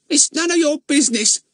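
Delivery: put-on high, sour old woman's snub; sullen old woman. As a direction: shut him out like a sulky crone. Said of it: "said the woman sullenly".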